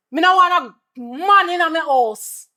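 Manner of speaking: high-pitched exasperated motherly rant; berating. Acted. Mother berates son about laziness.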